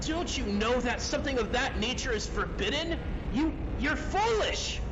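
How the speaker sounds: high-pitched, outraged reproach; alarmed, scolding father. Acted. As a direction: berate them in rising, horrified disbelief. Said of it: "you hear your father say".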